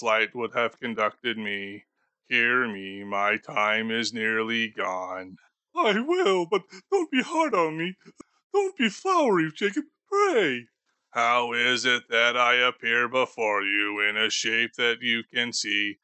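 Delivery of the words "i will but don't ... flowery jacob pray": put-on higher, frail old miser begging for comfort; a frightened old man pleading with his dead partner; pleading Scrooge.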